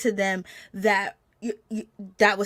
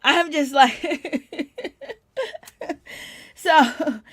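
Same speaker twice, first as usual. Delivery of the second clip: laughing; 5 semitones higher, much slower than usual. Real, not acted. Laughter heard on these lines.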